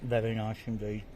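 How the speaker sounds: talking through a mouthful of food; words round a bite of fish; mouth full. Real, not acted. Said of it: "I shouldn't speak with my mouth full".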